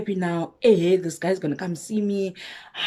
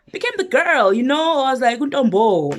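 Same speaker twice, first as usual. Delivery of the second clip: higher and louder, giddy with excitement; excited; 8 semitones higher, 7 dB louder than usual. Retold or imitated. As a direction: show off how great you feel now. Excited after getting dressed up.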